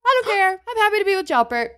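high-pitched, fed-up retort; defiant huff. Acted. Princess defying Tangerine over marrying Jalbert, huffy. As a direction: snap back in a high, huffy voice.

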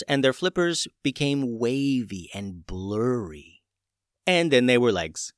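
usual speaking voice

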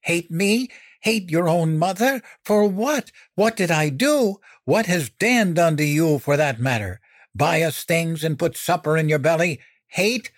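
high, incredulous mother's tirade; indignant, disbelieving outburst; mounting anger. A narrator's reading. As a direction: fire off outraged questions, pitch way up. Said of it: "struggling to clamp down on her mounting anger".

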